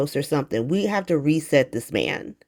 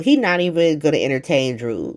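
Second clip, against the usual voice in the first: usual speaking voice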